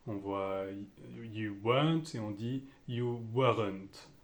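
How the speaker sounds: normal talking voice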